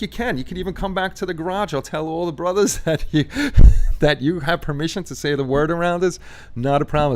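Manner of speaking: high-pitched insistent friend; mock coworker insisting it's fine. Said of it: "He's like".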